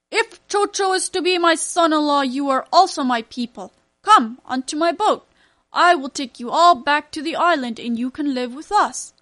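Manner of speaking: loud, generous royal proclamation; king's voice. A narrator's reading. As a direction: proclaim like a kind king. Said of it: "he said to the family".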